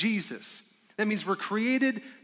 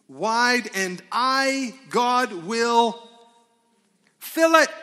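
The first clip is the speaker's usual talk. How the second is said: higher, louder, building to a shout on fill it; preaching shout; 5 semitones higher, 8 dB louder, much slower than usual. Acted. Preacher proclaiming God's promise to fill the open mouth, building to a shout. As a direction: rise to a full preaching cry.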